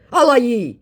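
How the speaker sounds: mocking the scammer's code word. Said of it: "he puts in again".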